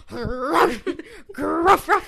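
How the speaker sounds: high, loud barking gruffs; dog bark. Acted. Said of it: "I'll bark for people".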